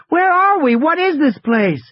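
put-on high, loud child shouting over noise; shouting over machinery. A narrator's reading. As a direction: yell over the roar of water. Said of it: "She had to shout over the noise".

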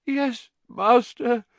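high, slow moaning answer. A narrator's reading. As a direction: moan it out in misery. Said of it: "moaned Wormtail".

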